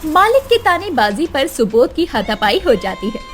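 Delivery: ordinary delivery, nothing marked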